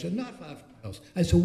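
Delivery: normal talking voice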